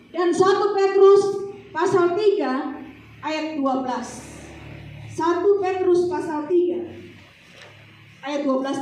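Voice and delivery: loud, high, slow call of the verse; loud announcement. Acted. Preacher loudly announcing the next scripture reference. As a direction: call it out to the room. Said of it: "preacher announcing next verse".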